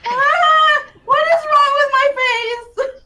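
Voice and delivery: high-pitched shrieks into a wail; scream, then wail the question; high, alarmed cry; panicked screaming. Acted. Character screams in alarm about face.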